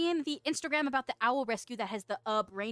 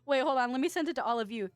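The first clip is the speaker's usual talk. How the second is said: ordinary talk, nothing marked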